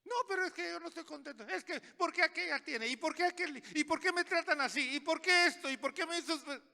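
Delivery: put-on high, fast whiny kid voice; mock childish tantrum, high-pitched and rapid; complaining child. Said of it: "Como cuando el niño está haciendo algo".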